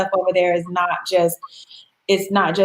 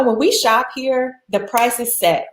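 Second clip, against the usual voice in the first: usual speaking voice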